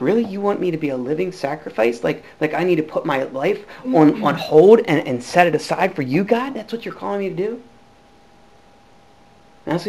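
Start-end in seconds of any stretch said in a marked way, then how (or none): 0.0-7.6 softer, lower disbelief; can't believe what is asked; incredulous complaint. Retold or imitated. A man reading Romans and questioning God, hushed and incredulous.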